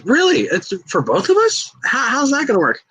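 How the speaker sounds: high, startled disbelief, lightly voiced; a wrestler caught off guard by the news; surprised Shorty G. Shorty G, surprised at the ladder match plan, voiced high.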